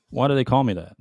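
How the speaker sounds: ordinary talk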